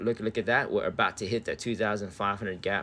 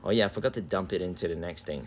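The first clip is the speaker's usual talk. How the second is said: ordinary talk, nothing marked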